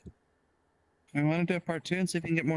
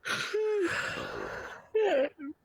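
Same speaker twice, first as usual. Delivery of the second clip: a long, high yawn. Real, not acted. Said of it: "Why am I yawning".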